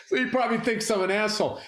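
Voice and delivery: talking fast through real laughter; words tumbling out on the tail of a laugh. Real, not acted. Comic admitting he snubbed his neighbour, laughing.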